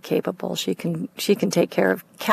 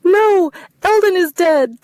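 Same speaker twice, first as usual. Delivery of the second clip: high, loud, slow sobbing words; 12 semitones higher, 5 dB louder, slower than usual. Acted. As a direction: sob the correction. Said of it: "Sob, sob".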